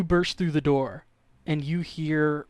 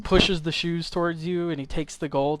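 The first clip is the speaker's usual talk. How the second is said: ordinary delivery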